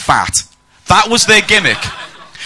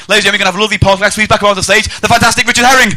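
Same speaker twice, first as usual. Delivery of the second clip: fast, raised, building showbiz announcement; proper announcer introduction; 7 semitones higher, 5 dB louder, much faster than usual. Real, not acted. Comic bringing the host back on stage, announcer introduction. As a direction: rattle it out, lifting to the name. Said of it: "I'm gonna do this properly".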